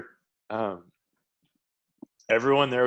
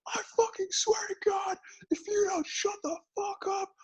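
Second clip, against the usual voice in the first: high-pitched strained mock threat, much higher; mock furious threat; 3 dB quieter than usual. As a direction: squeak out a furious threat like a cartoon tough guy. Retold threat, pinned to wall.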